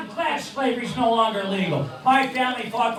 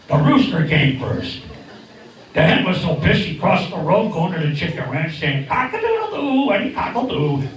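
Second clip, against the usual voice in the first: puppet bird voice; 5 semitones lower than usual. Acted.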